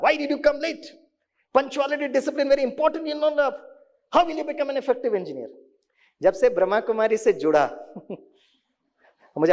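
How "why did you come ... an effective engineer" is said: higher-pitched scolding of a late student; angry scolding. Retold or imitated. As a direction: snap at the latecomer, pitched up. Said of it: "वही dialogue जो गुस्से से बोलता था". (गुस्से में डांटते हुए, ऊँची आवाज़ में)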